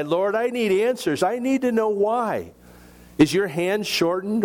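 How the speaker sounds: urgent indignant pleading; indignant plea. A narrator's reading. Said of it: "my indignant reply".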